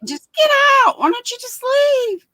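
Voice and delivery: high, mocking quote. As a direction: toss it off high and dismissive. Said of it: "I used to say that".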